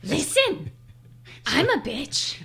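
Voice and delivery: high, loud talking-dog voice; Gia. Acted. Wife playing her own dog, high put-on voice. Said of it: "That's what my dog Gia sounds like".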